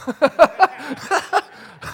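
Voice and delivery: big burst of laughter; loud laugh. Real, not acted. Preacher laughing at his own joke, loud laugh.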